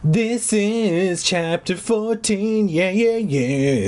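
high, very slow drawn-out announcing; mock announcement. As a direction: stretch "This is chapter fourteen" out like a grand reveal. Announces chapter in put-on voice.

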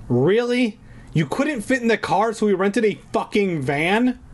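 high disbelieving outburst; can't believe this nonsense; incredulous. Real, not acted. Incredulous at story.

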